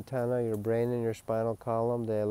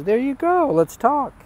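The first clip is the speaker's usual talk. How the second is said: much higher and louder, a friendly voice of the sun; the sun speaking; 12 semitones higher, 7 dB louder than usual. Pretend. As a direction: voice the sun welcoming you, high and bright in pitch. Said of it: "the sun goes".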